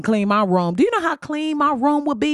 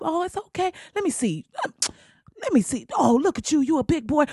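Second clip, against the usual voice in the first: lightly voiced soothing parent, higher and softer; a touch of comforting parent; 6 semitones higher, 3 dB quieter than usual. Parent comforting a boy who hurt himself, softly. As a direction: coax a hurt little boy gently. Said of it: "you pick 'em up".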